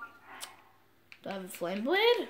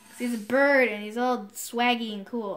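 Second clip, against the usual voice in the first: normal talking voice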